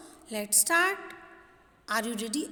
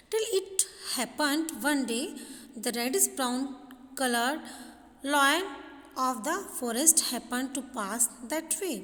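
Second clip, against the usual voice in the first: slow, deliberate textbook story reading; measured classroom reading with slow, separated phrases; lesson read-aloud; much slower than usual. Acted.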